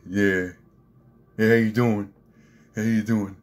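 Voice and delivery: hammed-up mock tough guy; brother's mocking swagger. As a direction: imitate a brother making fun of a swaggerer. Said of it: "he's making fun of me".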